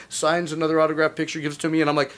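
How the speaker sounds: ordinary talk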